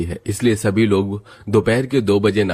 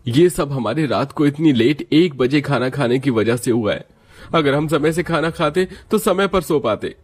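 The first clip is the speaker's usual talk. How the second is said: higher voiced grumble about the missed meeting; grumble, blaming the late dinners; annoyed young man; 6 semitones higher than usual. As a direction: son complaining to his family about his boss and late meals. Family member complains about late dinner.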